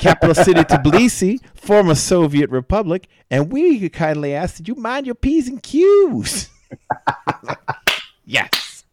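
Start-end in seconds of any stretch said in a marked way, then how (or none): 0.0-6.5 much higher, louder, stiff put-on Georgian accent; mock Georgian accent. Quoting Troy at a mock UN, hammed-up Georgian accent. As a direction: recite it like a formal foreign diplomat with a Georgian accent. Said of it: "Troy, you're Georgia, the country".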